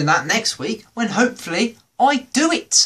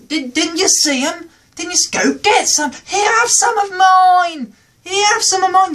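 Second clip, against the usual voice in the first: high-pitched mock voice, getting louder; much higher, insistent mock offer; pushy food sharer; 13 semitones higher, 4 dB louder than usual.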